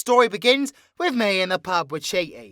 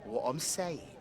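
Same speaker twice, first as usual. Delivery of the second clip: low, hushed and deliberate; quietly possessive, with weighty pauses; possessive claim; 7 semitones lower, 13 dB quieter than usual. Acted. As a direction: drop low and soft to stake your claim.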